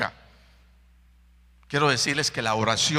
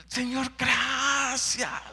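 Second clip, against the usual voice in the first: slow thankful prayer; 12 semitones higher, much slower than usual. Retold or imitated.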